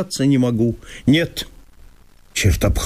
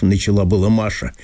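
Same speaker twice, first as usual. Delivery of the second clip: plain reading voice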